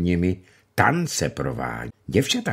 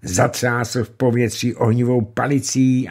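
usual speaking voice

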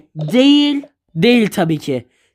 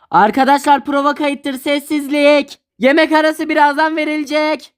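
calling out cues; 5 semitones higher than usual. Acted. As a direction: announce the take to the whole crew. Director calling set cues.

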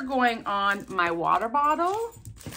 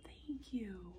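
very soft, breath-only thanks; hushed gratitude; grateful whisper; 19 dB quieter than usual. Real, not acted. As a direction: whisper it, really touched. Moved thanks for the tea.

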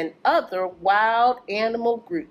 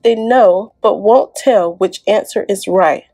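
louder, lively rhyming read-aloud; reading to children; 9 dB louder than usual. Acted. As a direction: bounce through the rhymes for the kids. Reads rhyming picture book aloud.

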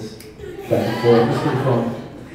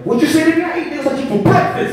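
high, loud outraged challenge; mock angry threat; 14 semitones higher, 6 dB louder, faster than usual. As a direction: yell it like a jealous boyfriend. Big angry man threatening the teller.

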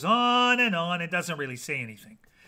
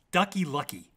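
ordinary delivery

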